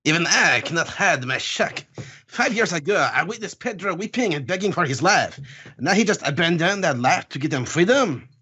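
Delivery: put-on theatrical accented Count, swooping pitch; grand accented speech, comically dramatic; put-on foreign accent. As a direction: declaim it in a hammed-up foreign accent.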